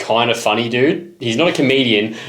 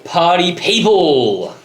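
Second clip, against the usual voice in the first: high, slow, drawn-out party host call; big hammed-up morning greeting; hype host greeting; 9 semitones higher, 4 dB louder, slower than usual. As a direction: call it out like a radio DJ. Hyped show greeting.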